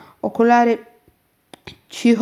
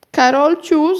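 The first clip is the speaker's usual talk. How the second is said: higher, louder cry of amazement; amazed call; 6 semitones higher, 5 dB louder, slower than usual. A narrator's reading. Aunt sees cakes appearing everywhere, amazed. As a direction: call out the name in astonishment. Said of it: "zawołała ze zdumieniem ciotka Agata".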